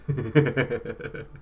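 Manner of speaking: light rapid chuckle at himself. Real, not acted. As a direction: chuckle at your own slip. Chuckles at his own mistake.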